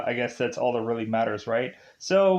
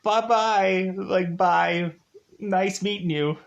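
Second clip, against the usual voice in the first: higher, sing-song sarcastic bye-bye; mock cheerful goodbye; 5 semitones higher than usual. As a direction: wave them off with fake cheer. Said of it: "the guys are like".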